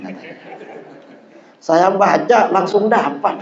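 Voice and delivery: normal talking voice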